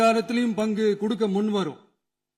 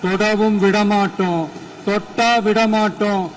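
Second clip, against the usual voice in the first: defiant vow; 6 dB louder than usual. Real, not acted. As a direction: thunder out the vow, climbing in pitch.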